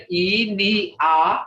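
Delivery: Ina broken into its sounds "I, ni, a"; separated sounds. Shown as a demonstration. Teacher breaking down the word for body, separated sounds. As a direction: sound out "I, ni, a" piece by piece. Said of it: "Hear that?".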